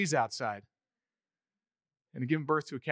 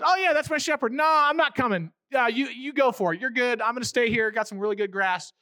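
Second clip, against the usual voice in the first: dismissive sheep; 5 semitones higher, 5 dB louder than usual. Acted. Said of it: "And she goes".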